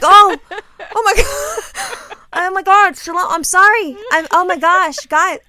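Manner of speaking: high, loud, flustered exclamations; mortified apology. Retold or imitated. Mother apologizing to her daughter after the slip, loud and mortified. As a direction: cry out in hammed-up embarrassment.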